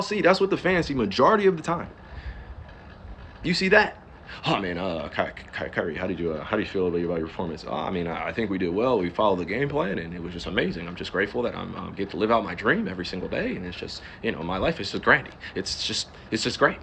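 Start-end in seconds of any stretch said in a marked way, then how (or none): 4.5-7.6 low, soft, hesitant press question; mock reporter voice. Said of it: "how easy it is to fake that". 7.7-16.8 low, mild, media-trained athlete voice; fake interview answer. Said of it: "there's nothing real about that".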